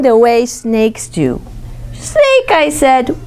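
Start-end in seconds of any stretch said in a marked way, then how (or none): 1.9-2.5 calling snake. Acted. Girl calls the snake.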